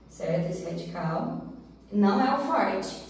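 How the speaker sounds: ordinary talk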